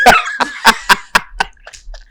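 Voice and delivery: high, unvoiced burst of laughter; high breathy laugh. Real, not acted. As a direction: break into airy laughter.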